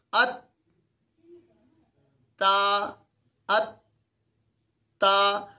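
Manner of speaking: "ات" stopped on the tongue, then long level "تا"; slightly exaggerated demo. Shown as a demonstration. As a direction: halt the t in "ات", pause, release into "تا". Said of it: "تو تھوڑا سا زیادہ کر رہا ہوں".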